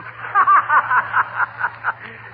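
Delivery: amused gloating chuckle. Real, not acted. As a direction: chuckle at the trouble he made. Gloats about trouble coming.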